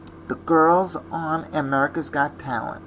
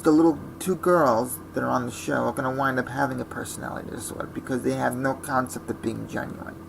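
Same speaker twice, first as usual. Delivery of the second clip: normal talking voice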